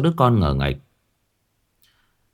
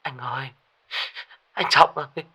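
much higher, tearful, desperate; a mother crying that her son is missing; sobbing plea; 10 semitones higher than usual. A narrator's reading. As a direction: plead through tears, pitch rising. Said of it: "tiếng của chị Thu nức nở".

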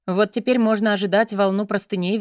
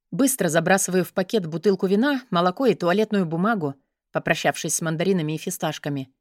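ordinary delivery

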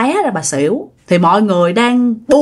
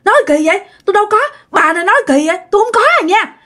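high, rushed protest; panicky, fast denial, pitched way up; denying; 10 semitones higher, much faster than usual. Retold or imitated. Three suspects denying the accusation, high fast protest. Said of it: "nó vẫn chối".

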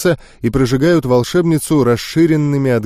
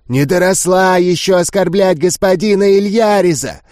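furious ghouls; 6 semitones higher than usual. Acted. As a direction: spit the insult at the human girl. Ghouls insult the girl.

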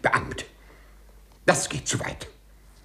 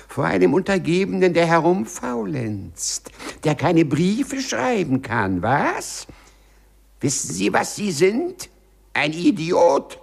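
gloating insults; 9 semitones higher than usual. Acted. Clerk's head in the wall mocking his boss, high gloating tirade. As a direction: taunt him with glee and scorn.

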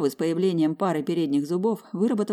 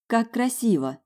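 high, bright exclamation of delight; 7 semitones higher, slower than usual. Acted. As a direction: gasp in admiration. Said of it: "восхитилась я".

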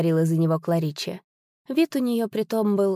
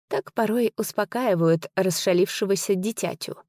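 usual speaking voice

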